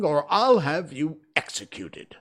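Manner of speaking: loud, high royal threat; threatening king. A narrator's reading. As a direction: threaten him with full authority.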